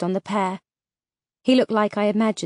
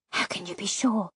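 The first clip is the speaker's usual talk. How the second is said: softer, urgent hiss; 7 dB quieter than usual. A narrator's reading. Vega challenges Lackland near the nest, hissed softly. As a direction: hiss it under your breath. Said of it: "I hissed back".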